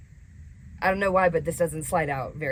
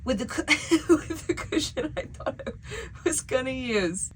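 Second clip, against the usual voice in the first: high-pitched laugh through the words; exasperated, breaking into laughter; laughing in dismay; 9 semitones higher than usual. Real, not acted. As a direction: laugh helplessly while explaining. Laughing over her failed build.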